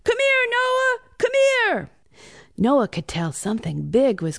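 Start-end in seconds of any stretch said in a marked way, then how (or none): 0.0-1.9 high-pitched excited yell; urgent calling. A narrator's reading. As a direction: shout urgently for a brother to come. Ian calls his brother upstairs.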